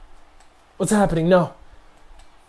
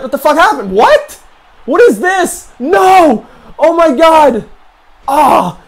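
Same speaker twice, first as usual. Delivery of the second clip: high, loud panicked yelling; 10 semitones higher, 8 dB louder, slower than usual. Real, not acted. Player panicking as he loses, yelling. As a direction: panic as it all goes wrong.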